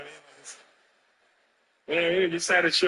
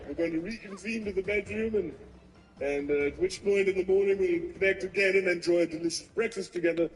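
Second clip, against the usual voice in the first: formal host; 2 semitones higher, 9 dB quieter than usual. Retold or imitated. Host voiced formally in story.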